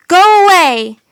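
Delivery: high, loud shout. A narrator's reading. Jack driving the wolves away, loud high shout. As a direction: chase them off. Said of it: "he shouted".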